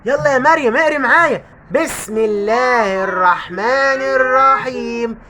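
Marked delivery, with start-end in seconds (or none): 1.7-5.2 slow, drawn-out chanted recitation; Quran recitation. Acted. Father reciting Quran with his daughter, slow chant. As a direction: recite solemnly to drive out ghosts.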